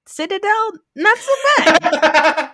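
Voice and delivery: high, slow, laughing grudging admission; playful reluctant praise; grudging concession. Real, not acted. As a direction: give it up through a laugh, slowly. Grudging praise after criticism.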